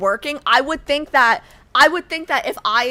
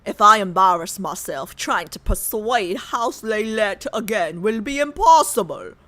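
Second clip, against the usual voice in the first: lower-pitched British-accent read; 7 semitones lower than usual. Shown as a demonstration. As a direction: drop the voice for the line. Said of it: "let me go low".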